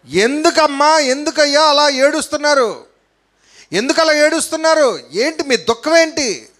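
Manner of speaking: high, insistent questioning; preacher acting exhortation. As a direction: challenge the weeping crowd from the pulpit.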